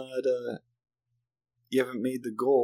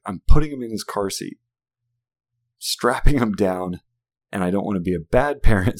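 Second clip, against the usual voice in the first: louder, fed-up dad reenactment; emphatic, exasperated retelling of laying down the law; retold frustration; 7 dB louder than usual. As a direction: act out a parent at the end of patience. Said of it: "I was so frustrated".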